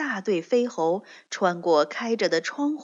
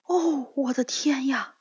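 higher, awed exclamation; amazed cry; 5 semitones higher than usual. Acted. Scarecrow on the wall top seeing the city, higher awed cry. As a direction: gasp at the sight. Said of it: "他喊道".

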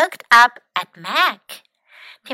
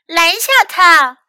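raised, louder urgent call; crying out; 5 semitones higher, 5 dB louder than usual. A narrator's reading. Pinky urges Tim to stop Mac, crying out. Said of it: "cried Pinky".

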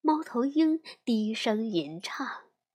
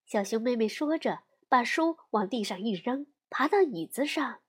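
plain reading voice

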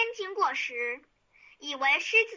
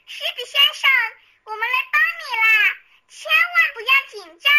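high, louder call to the lion; 9 semitones higher, 4 dB louder than usual. A narrator's reading. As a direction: reassure the trapped lion loudly. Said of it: "她大声地对狮子说".

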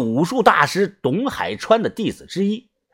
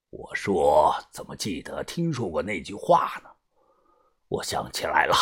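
put-on low older man voice; 12 semitones lower, 9 dB quieter than usual. Boss recalls the old story.

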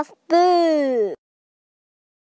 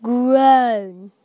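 toad groan; 5 dB louder than usual. Acted. Toad groaning under load.

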